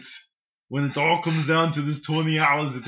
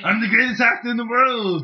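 Arnold boast; 7 semitones higher, 4 dB louder than usual. Acted.

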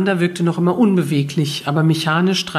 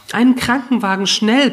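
higher, louder urgent demand; pressed out urgently; 6 semitones higher, 3 dB louder than usual. A narrator's reading. Narrator urging her husband to call an ambulance, pressed out urgently. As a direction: force it out in alarm. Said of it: "presste ich hervor".

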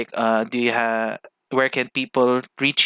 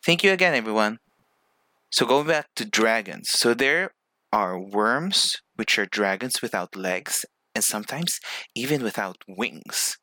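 ordinary delivery

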